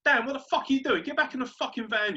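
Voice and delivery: loud, high yell to a mate; retold shout. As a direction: shout across the street in exasperation. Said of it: "I just shouted".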